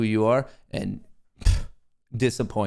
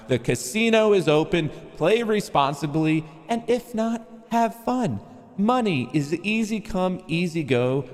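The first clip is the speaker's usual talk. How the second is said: higher, lilting casino announcer; 7 semitones higher than usual. Acted. Streamer welcoming chat to the casino, light announcer voice. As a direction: sell it like a showman. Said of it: "I'm still on this announcement voice".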